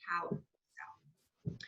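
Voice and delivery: whispered, breathy example; hushed model phrase. Shown as a demonstration. Teacher modelling what a host says, whispered. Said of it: "always say".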